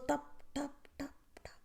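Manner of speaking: soft whispered dripping sounds; whispered tap tap. Shown as a demonstration. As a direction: whisper each tap like a falling drop. Water drops falling on faces.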